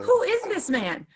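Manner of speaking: high, loud, slow disbelief; retold amazement. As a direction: stretch out the question in mock astonishment. Retelling her amazed reaction.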